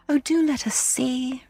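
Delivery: high, drawn-out plea; wheedling children asking to see; entreating children. A narrator's reading. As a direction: beg slowly in a child's high voice. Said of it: "they entreated".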